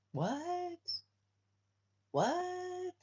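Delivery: high, soft, drawn-out baffled "what"; stunned falsetto double take. Retold or imitated. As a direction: squeak it out in disbelief. Baffled reaction to attacking dogs.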